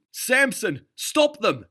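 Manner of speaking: very high, loud yell; first mate yelling for Samson; calling out. A narrator's reading. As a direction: yell across the deck to stop them. Mate calling out across deck.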